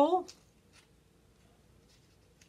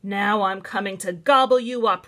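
loud troll threat; roaring troll; 7 dB louder than usual. A narrator's reading. Troll threatening the biggest goat, loud roar. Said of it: "roared the troll".

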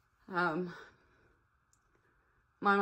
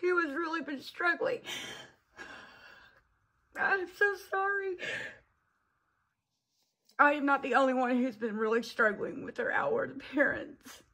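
crying; 7 semitones higher than usual. Real, not acted. Vlogger breaks down mid-sentence.

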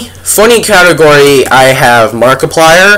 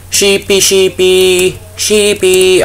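slow, higher sing-song calling of a pet; coaxing pet call; 4 semitones higher, 3 dB quieter, slower than usual. Pretend. Gamer lures a sheep, slow sing-song coaxing call. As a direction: coax the sheep over sweetly.

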